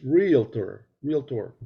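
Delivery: realtor said slowly as REEL-tor; deliberate "reel-tor" for realtor, no middle vowel; correct pronunciation demo. Shown as a demonstration. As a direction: spell out realtor in two careful beats, REEL-TOR. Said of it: "how to pronounce the word realtor".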